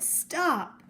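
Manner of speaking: put-on a girl shouting as a moose walks in; girl crying out. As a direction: cry out to stop him entering. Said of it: "A girl cried".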